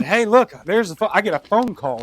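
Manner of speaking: mock-excited, like he just spotted a payphone; eager retold exclaim. Drunk young man to arresting cop, eager retold exclaim. Retelling his first arrest.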